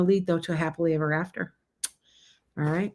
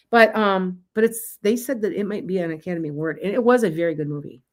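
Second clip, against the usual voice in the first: usual speaking voice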